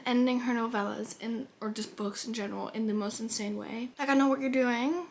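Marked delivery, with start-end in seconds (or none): none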